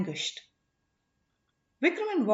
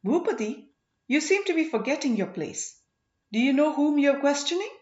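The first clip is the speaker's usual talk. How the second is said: higher, louder, haughty reprimand; fiery rebuke; 6 semitones higher, 4 dB louder than usual. A narrator's reading. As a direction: put him in his place. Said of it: "giving him a fiery look".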